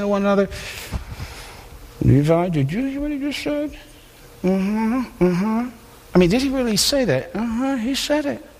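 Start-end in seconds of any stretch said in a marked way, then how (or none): 2.0-8.5 gossiping scribes. Retold or imitated. Scribes whispering to one another.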